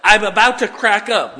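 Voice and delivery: high, loud mock-panicked cry; mock panicky listener. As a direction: ham up a frazzled churchgoer. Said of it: "you say".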